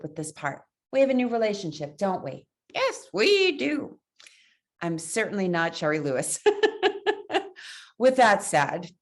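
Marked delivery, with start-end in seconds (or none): 2.7-4.0 high, slow cute puppet voice. Acted. Answering for the puppet. 6.4-7.5 high giggle. Real, not acted. Laughs at own puppet act.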